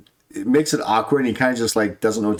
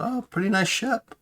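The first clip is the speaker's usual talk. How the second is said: high soft remark; pitched up, lightly impressed; NPC voice; 11 semitones higher, 4 dB quieter than usual. Acted. GM voicing crew remark.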